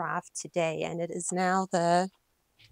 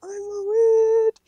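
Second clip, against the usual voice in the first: much higher, louder, slow oh my word; overjoyed squeal; 12 semitones higher, 11 dB louder, much slower than usual. Real, not acted. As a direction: let the joy squeal out. Overwhelmed by gift.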